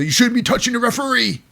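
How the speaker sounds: high-pitched mock telling-off; imitated indignant reprimand, pitched high; mock scolding. Acted scolding over the referee.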